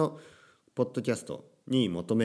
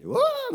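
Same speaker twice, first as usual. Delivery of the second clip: a very high, loud "whoa" whoop; overexcited yell; 6 dB louder, slower than usual. Shown as a demonstration. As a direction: whoop like a hyped-up host. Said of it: "元気すぎる感じ".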